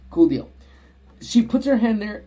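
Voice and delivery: usual speaking voice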